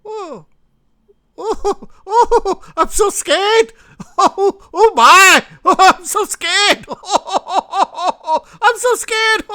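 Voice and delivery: very high, laughing mock fright; high falsetto sarcasm, laughing through "I'm so scared". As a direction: squeal it like a fake-terrified bully, cracking up. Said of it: "and Russia's going".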